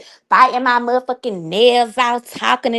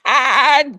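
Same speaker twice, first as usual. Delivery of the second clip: burst of laughter. Real, not acted. Laughs at own roast.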